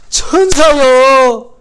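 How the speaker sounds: loud high drawn-out cry of surprise; a mock gasp at seeing angels; hammed-up shout of surprise; startled cry. Mary acted seeing angels.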